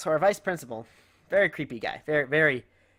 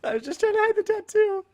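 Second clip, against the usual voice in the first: very high, loud whine; high embarrassed squeal; 5 dB louder than usual. Retold or imitated. Son caught hiding girlfriend's tattoo, high comic squeal. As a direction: squeak it out in mock desperation.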